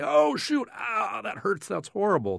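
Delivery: high, mock pained yelp of a hurt person; imitating someone hit on the thumb, going up high; pained outcry. Professor imitates a normal person's reaction to pain, a high mock outcry. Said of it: "the way someone would, normally".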